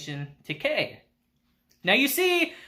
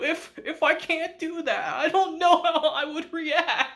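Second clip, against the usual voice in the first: high, hammed-up plea; mock desperate pleading; 8 semitones higher than usual. Kei begging to clean Kushida's uniform, high mock pleading. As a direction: overplay the distraught apology in a high voice.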